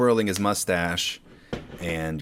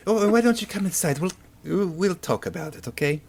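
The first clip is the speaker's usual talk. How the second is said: much higher, fluttery mom; flustered mom; 9 semitones higher than usual. Acted. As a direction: fuss over the guest nervously. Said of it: "Your mom is like".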